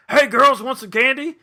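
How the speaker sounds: loud, high, cheery hail; calling out. Retold or imitated. Costumed man hailing two teenage girls, loud high call. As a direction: holler the offer at them.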